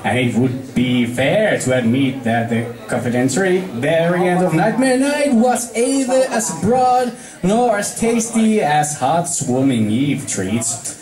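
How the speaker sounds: usual speaking voice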